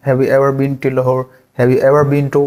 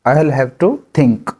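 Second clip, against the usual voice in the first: drawn out slowly; much slower than usual. Shown as a demonstration. Teacher models the sentence slowly. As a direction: say it slowly for learners. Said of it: "तो यहाँ पे क्या बोलेंगे इसको".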